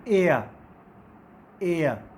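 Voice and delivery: teacher modelling the air sound; diphthong demo. Shown as a demonstration. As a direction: stretch एयर from eh into uh. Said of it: "that is".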